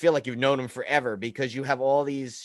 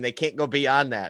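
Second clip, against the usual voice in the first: ordinary talk, nothing marked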